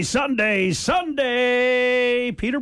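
booming radio announcer, building. Shown as a demonstration. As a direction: hype it like a monster truck ad. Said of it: "put on our best announcer voice".

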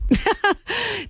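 bright high giggle; a quick, high, happy laugh. Real, not acted. Host laughs after weather remark.

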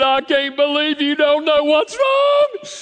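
exaggerated high-pitched crying outburst; mock crying wail. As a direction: imitate an upset wife wailing in disbelief. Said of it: "you look up and she's crying".

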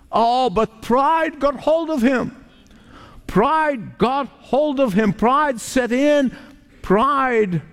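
slow, high, insistent; fervent preaching. Acted. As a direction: dwell on each word of pride. Preaching about pride, slow and high.

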